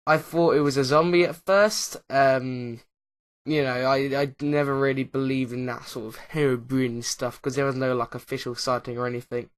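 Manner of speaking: ordinary talk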